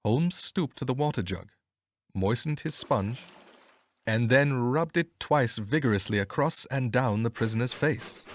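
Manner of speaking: ordinary delivery, nothing marked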